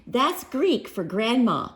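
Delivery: usual speaking voice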